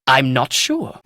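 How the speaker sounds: ordinary talk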